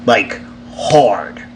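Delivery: breathy whisper, falling; whispered emphasis. Retold or imitated. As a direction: whisper it for weight. Stressing how hard he hit.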